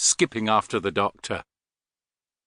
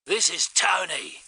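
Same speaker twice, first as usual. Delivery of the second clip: high, slow Australian over radio; crackling radio voice; 6 semitones higher than usual. A narrator's reading. Tony to Astrid over the receiver, radio voice. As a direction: call in slowly through a walkie-talkie. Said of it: "crackled the receiver".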